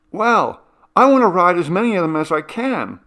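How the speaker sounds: high, loud and fast, unstoppable; eager insistence. Acted. Cindy insisting to Brianna she'll ride everything, eager. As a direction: brush off the money worry eagerly.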